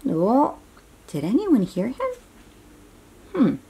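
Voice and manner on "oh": ordinary delivery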